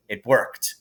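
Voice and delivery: usual speaking voice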